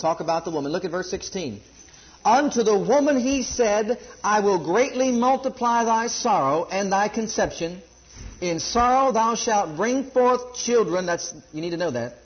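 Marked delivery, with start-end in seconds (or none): 2.2-12.2 higher, louder and slow, declaiming; solemn scripture reading. Acted. Preacher reading Genesis to the congregation, solemn and declaiming. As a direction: intone the verse like a pulpit proclamation.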